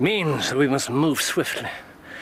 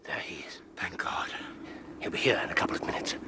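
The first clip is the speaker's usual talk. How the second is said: whispering; 5 semitones lower, 10 dB quieter, faster than usual. Acted.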